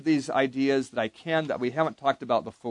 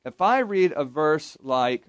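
ordinary talk

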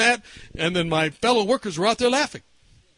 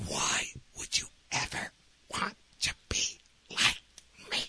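whispered wonder; 12 dB quieter than usual. Retold or imitated. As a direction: whisper each word in disbelief. Patient answers in whispers.

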